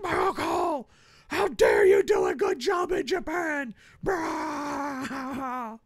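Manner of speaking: high mock screaming boss; mock furious boss shout. Mocking Vince screaming at his announcer, shrill and high. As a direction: yell it like an outraged boss in a hammed-up impression. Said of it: "He's like".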